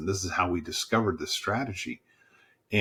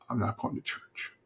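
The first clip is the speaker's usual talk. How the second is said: much softer, almost under the breath; hushed recollection; 2 semitones higher, 8 dB quieter than usual. Retold or imitated. Pastor recalling neighbour's words of five years earlier, hushed. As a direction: drop the quoted refusal to a hush.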